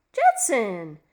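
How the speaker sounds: sharp startled cry of his name; surprised exclaim. A narrator's reading. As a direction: call his name out in shock. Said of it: "she exclaimed".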